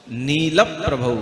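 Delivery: नील प्रभौ with weight on नील before प्र; slow, careful नील प्रभौ; slow model reading. Shown as a demonstration. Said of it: "कैसे पढ़ना".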